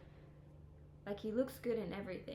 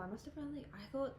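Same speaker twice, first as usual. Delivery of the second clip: ordinary delivery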